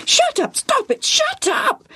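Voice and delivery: very high squawking bird voice; screaming parrot. A narrator's reading. Parrot bursting into the tent, screaming. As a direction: squawk each phrase like a parrot. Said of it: "A big green bird had flown in through the tent doorway screaming".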